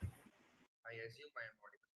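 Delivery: faint, far-off voice on a weak mic; very soft, barely heard over the line; barely audible. Real, not acted. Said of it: "Smith, we can't hear you".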